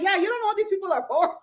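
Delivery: quick, high quote tipping into laughter; rushed, giggly mock agreement; laughing imitation. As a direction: crack up while mimicking the chime-in.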